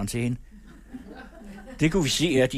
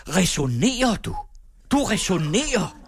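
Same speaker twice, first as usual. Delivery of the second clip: higher, louder, incredulous outrage; indignant scolding; 7 semitones higher, 3 dB louder than usual. A narrator's reading. Teacher furious that the pupil argues, loud and high. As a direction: throw the word back at her in fury.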